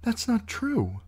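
a frightened protest; a niece denying; young Sansa. Acted.

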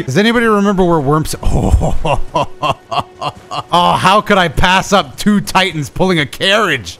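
normal talking voice

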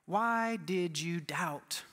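very slow, weighted delivery; slow gentle rebuke. Acted. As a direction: stretch out each word, solemn and searching. Acted rebuke of Peter by Jesus.

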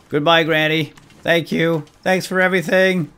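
high, loud farewell shout; calling out goodbye. Pretend. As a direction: call back to someone as you leave. Calls farewell to game character on leaving.

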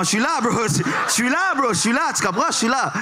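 high, insistent pleading; begging the screen to come back. Acted. Stand-up, pleading at frozen screen. (supplier, aigu et insistant)

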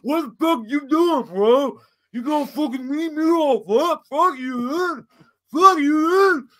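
high, worked-up imitation of a brother picking a fight; mock angry confrontation. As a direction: yell it like you're squaring up. Brother confronting him, quarrel retold.

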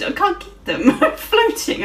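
real laughter breaking through the words, pitch jumping high; laughing. Real, not acted. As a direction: laugh helplessly while talking. Stuck, laughing at the game.

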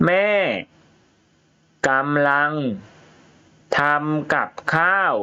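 a teacher reading a story to the class, word by word; reading to children. Shown as a demonstration. Reads story aloud to pupils.

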